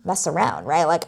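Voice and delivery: normal talking voice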